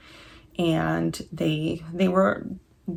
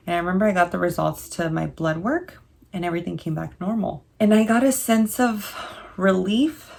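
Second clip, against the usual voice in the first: normal talking voice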